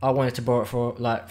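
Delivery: ordinary delivery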